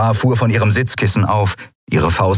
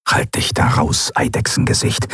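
hissed put-down; 4 semitones lower than usual. Acted. Zaylar snaps at lizard.

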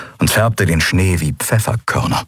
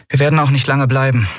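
higher and fast; brisk captain's promise; Endriel, promising; 7 semitones higher, faster than usual. A narrator's reading. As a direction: reassure them quickly. Endriel's voiced figure.

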